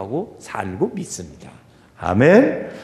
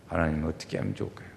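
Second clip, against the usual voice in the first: quiet listening prayer; 10 semitones lower, 10 dB quieter, faster than usual. Retold or imitated. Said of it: "가만히 묵상하는 시간".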